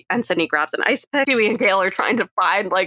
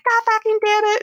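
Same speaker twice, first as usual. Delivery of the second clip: mock tantrum. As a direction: throw a silly high-pitched fit as the villain. Roman voiced in mock tantrum.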